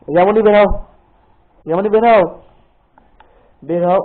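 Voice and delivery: loud call with a falling end to draw her out. Real, not acted. Mother calls daughter hiding under quilt, loud call. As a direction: call her out from the next room.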